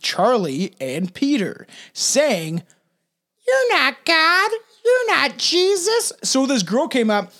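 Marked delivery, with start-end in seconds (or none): 3.5-6.1 high, slow put-on girl's voice; young girl's accusation. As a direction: draw out each denial in a high-pitched voice. Voices young girl challenging them.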